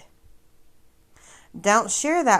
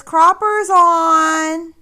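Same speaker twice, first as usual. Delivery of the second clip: mock announcement; 9 semitones higher, 9 dB louder, much slower than usual. Reading her message in mock voice.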